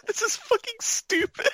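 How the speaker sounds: squeaky laughing exasperation; laugh-crying the line; high laughing cry. Real, not acted.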